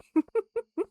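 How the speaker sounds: short, high laugh that rises; a squeaky giggle escaping; high burst of laughter. Real, not acted. Streamer still giggling over the fortune, high laugh. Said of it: "I am laughing".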